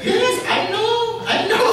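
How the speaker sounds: very high, very slow, loud and laughing; laughing mock reply. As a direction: drag out "I know" through laughter.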